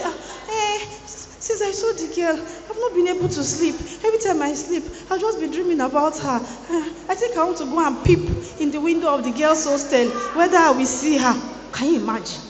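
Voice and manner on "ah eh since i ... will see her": put-on high, dreamy mooning boy; imitating lovesick boy. Preacher mimics a smitten boy, high dreamy voice. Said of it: "you now hear the boy say".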